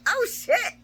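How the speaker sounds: high, stunned exclamation; shocked gasp. Retold or imitated. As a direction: react like trouble just broke out. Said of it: "And Maxie's like".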